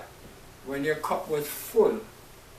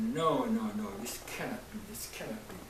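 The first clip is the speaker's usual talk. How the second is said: fading, much softer no, no, this cannot be; quiet despair; 4 semitones lower, 8 dB quieter than usual. Retold or imitated. Preacher retelling his despair to God, quiet and falling. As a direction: sink into a soft, quicker disbelief. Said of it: "I said".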